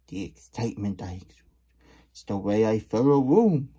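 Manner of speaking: loud, swaggering self-praise; boastful Big Cheese. Acted. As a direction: puff up and show off to the crowd. Reading the Big Cheese book aloud.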